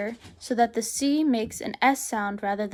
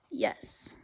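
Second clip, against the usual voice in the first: whisper; 9 dB quieter than usual. Real, not acted. Aside in French lesson.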